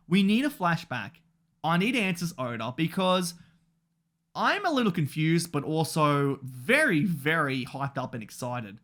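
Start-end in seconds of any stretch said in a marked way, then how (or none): none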